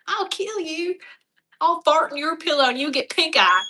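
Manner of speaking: high-pitched hillbilly mock threat; hillbilly accent. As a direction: threaten comically in a thick Southern twang. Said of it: "it gets real hillbilly".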